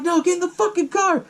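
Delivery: high, strained mock command; urgent order. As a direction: imitate a frantic order to a friend. Axel urgently ordering her in.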